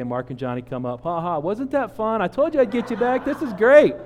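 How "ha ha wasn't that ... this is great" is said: high gloating taunt, loud at the end; gleeful gloating. Retold or imitated. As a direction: rub it in with triumphant glee. Gloating after the prank.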